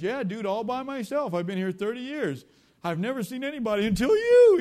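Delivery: loud, high castaway outburst, building to a shout; wildly excited. Retold or imitated. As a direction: gush loudly at your first visitor in years. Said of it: "why I'm so excited to see you".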